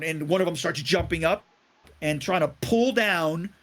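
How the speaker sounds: normal talking voice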